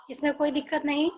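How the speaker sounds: ordinary talk, nothing marked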